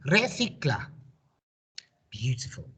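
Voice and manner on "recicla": Recicla as one smooth word, re-THI-cla with a lisped c; sounds squeezed together. Shown as a demonstration. Teacher modelling the whole word for pupils to repeat, blended smoothly. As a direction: run the syllables into one word. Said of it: "One more time".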